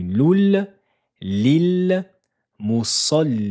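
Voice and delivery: loul lil pitched high and loud; teacher emphasizing the lam sounds in the drill; doubled lam emphasis. Shown as a demonstration. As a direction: hit loul and lil hard. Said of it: "le premier son de la lettre lem".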